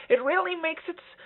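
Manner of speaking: high voice with a tightened throat; squeezed high talking; tight throat. Shown as a demonstration. Coach catching a tight-throated high take, pinched high voice. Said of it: "I just, I just tightened my throat".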